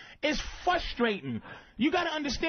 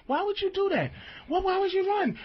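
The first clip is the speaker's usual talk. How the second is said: mock girlfriend yelling; 7 semitones higher than usual. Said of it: "right, and goes".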